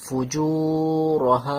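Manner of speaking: ফুজু with long u, রহা with long final a; slow recitation, holding জু and হা; elongated vowels. Shown as a demonstration. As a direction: stretch জু and হা, keep র short. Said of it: "টান নেই তো টান দিচ্ছি না".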